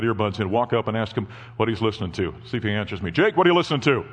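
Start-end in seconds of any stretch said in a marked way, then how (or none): 3.1-4.0 raised, higher call across the room. Real, not acted. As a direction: call out a question to your son. Calls to son at back.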